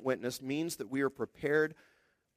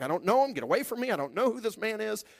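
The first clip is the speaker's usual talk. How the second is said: angry denial; 7 semitones higher, faster than usual. Retold or imitated. Peter swearing, denying Jesus.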